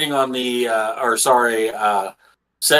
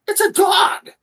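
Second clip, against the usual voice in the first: high-pitched excited squeal; delighted, very high-pitched cry; excited dog lover. Acted. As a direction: shout it high, thrilled to spot a dog. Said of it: "You look over and you're like".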